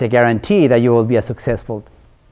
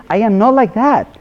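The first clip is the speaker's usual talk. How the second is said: mock defensive protest; 10 semitones higher, 4 dB louder than usual. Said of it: "I've heard from".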